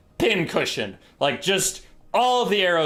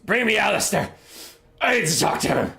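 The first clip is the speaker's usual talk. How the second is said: king's command; 5 semitones higher than usual. Acted. King repeats demand.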